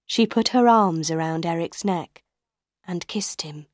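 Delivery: usual speaking voice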